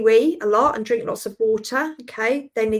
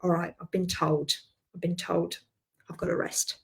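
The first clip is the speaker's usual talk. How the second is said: resigned giving in; 4 semitones lower, 9 dB quieter than usual. Retold or imitated. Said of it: "I'm like".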